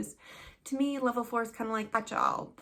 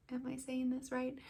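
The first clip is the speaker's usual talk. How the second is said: soft, tentative question; small, unsure aside; unsure student; 7 dB quieter than usual. Retold or imitated. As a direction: quietly imitate a student checking with the teacher. Voices an unsure student's questioning look.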